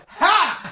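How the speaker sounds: a single cocky bark of a laugh; mock laugh. Recruit laughing back at the sergeant, cocky. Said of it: "And then I went".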